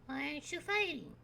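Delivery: put-on a lost kid looking around; small confused child calling out; Rad the kid. GM voices Rad.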